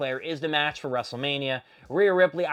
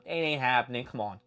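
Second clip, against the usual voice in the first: ordinary delivery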